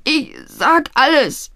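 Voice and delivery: high, slow, whimpering surrender; cowed lad giving in; frightened stammer. A narrator's reading. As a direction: give in with a pitiful high voice. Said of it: "stammelte der junge Mann".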